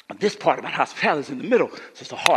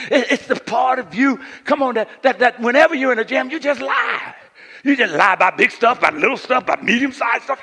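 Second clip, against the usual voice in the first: higher, louder preaching; rising preaching; 9 semitones higher, 9 dB louder than usual. Acted. Pastor confronting the congregation about lying, higher and louder. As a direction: press the point on the congregation.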